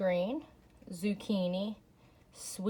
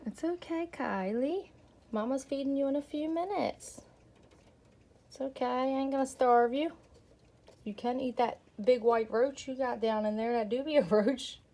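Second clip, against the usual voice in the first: higher cooing pet talk; 5 semitones higher than usual. Real, not acted. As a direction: coax a hungry pet sweetly. Soothing hungry chameleon.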